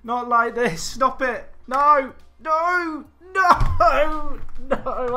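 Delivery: high, loud, rising cries of no; desperate wail. Real, not acted. Gamer screaming as the opponent scores against him. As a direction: scream it as the goal goes in.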